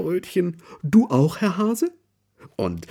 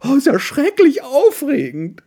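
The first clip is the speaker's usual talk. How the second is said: very high, loud, thrilled bear voice; excited call; 7 dB louder than usual. A narrator's reading. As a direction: cry out in delight at the moon and stars. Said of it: "ruft sie begeistert".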